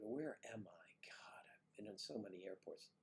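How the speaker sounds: whispered to himself; unvoiced, musing under breath. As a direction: mutter it privately to yourself. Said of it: "I went".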